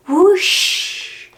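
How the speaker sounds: breathy high whoosh of wind. Acted. Reading to a child, a breathy wind whoosh. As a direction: blow it out like winter wind.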